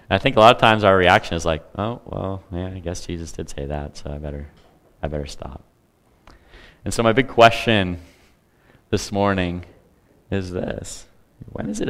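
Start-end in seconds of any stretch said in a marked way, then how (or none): 1.8-5.6 sheepish retreat. Retold or imitated. Meek reaction to rebuke.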